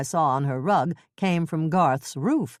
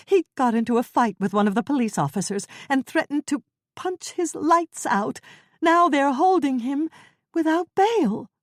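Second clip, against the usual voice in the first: put-on high, rising to louder, upset elderly voice; distressed old woman; 9 semitones higher, 3 dB louder than usual. Ethel telling Jane her husband was jailed, high and upset. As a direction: pour it out in a panic.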